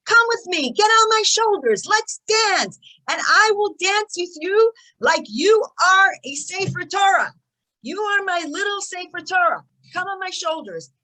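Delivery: put-on excited soldier calling the boy onto his shoulders; joyful invitation. Soldier invites child to dance.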